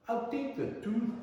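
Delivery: a hint of a grand lady enquiring; voicing the Scottish lady.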